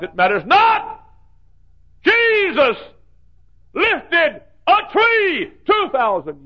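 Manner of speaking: from loud to high, loud, slowed ringing climax; preaching building. Acted. Preacher building to a shouting climax.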